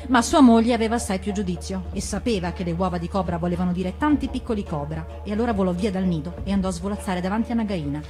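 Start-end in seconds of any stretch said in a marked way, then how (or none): none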